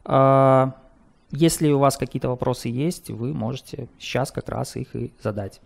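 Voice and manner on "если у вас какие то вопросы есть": ordinary talk, nothing marked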